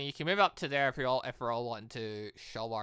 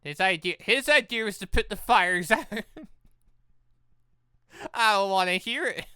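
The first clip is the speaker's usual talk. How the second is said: mock outrage; 7 semitones higher, 9 dB louder than usual. Mock protest over credit.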